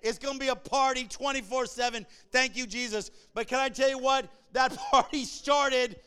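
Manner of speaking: loud, high-pitched preacher in full flight; full-force preaching. Acted. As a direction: preach it out to the whole congregation, punching key words.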